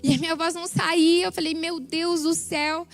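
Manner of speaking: ordinary talk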